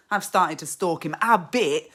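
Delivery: normal talking voice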